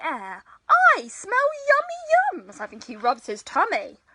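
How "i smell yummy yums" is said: high, loud delighted yelp; excited Badger. A narrator's reading. Badger sniffs the air and smells food, high and excited. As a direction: sing out with hungry delight.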